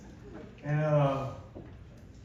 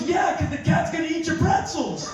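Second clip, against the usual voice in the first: mock exasperated wife; 11 semitones higher, 4 dB louder than usual. Wife snapping explanation.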